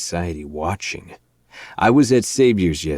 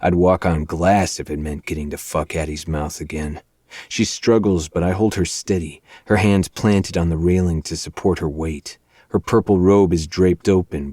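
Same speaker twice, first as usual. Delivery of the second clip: usual speaking voice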